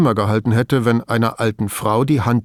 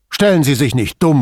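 loud, pitched-up reprimand; irritated rebuke; 4 semitones higher, 7 dB louder than usual. A narrator's reading. Boss scolding Brunetti for asking why, irritated. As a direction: cut him down impatiently. Boss snaps at questioning subordinate.

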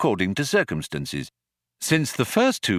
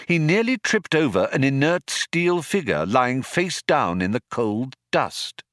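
ordinary delivery, nothing marked

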